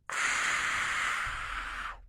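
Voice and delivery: breathy whispered "ah" like warming cold hands. Shown as a demonstration. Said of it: "Just whisper".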